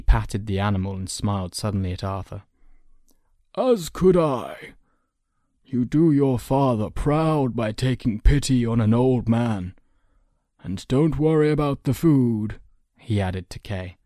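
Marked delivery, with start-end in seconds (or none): 3.6-12.6 put-on grateful old man. Old man accepting the boy's hospitality, grateful.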